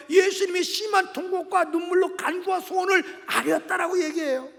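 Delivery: impassioned retelling from the pulpit; fervent preaching. Real, not acted. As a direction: preach it forcefully, surging on key words. Preaches at full force.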